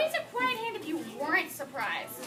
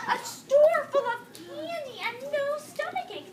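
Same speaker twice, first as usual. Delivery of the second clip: put-on excited kid; 6 semitones higher, 4 dB louder, slower than usual. A narrator's reading. Eager kid's wish.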